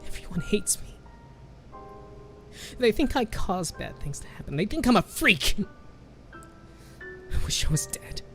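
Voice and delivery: choked, whispery despair rising then fading; tearful. A narrator's reading. Girl crying to her grandmother, tearful whisper. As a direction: say it through tears. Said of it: "tears turned into sobs".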